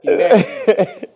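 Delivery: hammed-up shout, high and loud; mock furious father. Father yelling at his son who embarrassed him, hammed-up shout. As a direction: yell it like a dad disgraced by his son.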